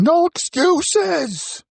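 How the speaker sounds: high, drawn-out mock yell; drill-style yell. Podcaster mimics someone yelling about discipline, mock yell. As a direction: yell it high and stretched out, like an imagined enforcer. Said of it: "someone yelling".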